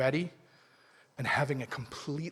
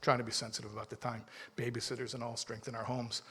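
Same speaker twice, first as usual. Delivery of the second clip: softer aside, dropped low; off-the-record mumble; 5 semitones lower, 9 dB quieter than usual. Real, not acted. Preacher muttering an aside to the audience, quietly. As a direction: mumble it so only half the room hears. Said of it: "I mumble, I say low".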